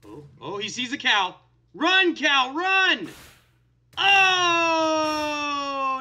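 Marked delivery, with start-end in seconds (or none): 1.7-3.1 high, loud mock-panicked yell; urgent shout. Player urging a hunted cow to flee, urgent shout. 4.0-6.0 long, high, loud wail; dismayed cry. Pretend. Player watching the cow get killed, dismayed cry.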